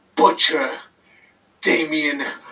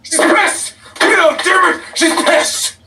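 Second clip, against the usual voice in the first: much higher and louder, yelling; frantic shout; 11 semitones higher, 3 dB louder than usual. Acted. Wrestler yelling at Guido about his partner, frantic shout. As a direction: lose it completely, screaming.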